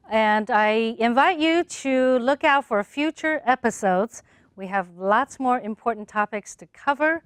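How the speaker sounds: bright, upbeat show outro; host sign-off. Acted. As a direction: wrap the episode warmly for listeners. Host outro announcement read.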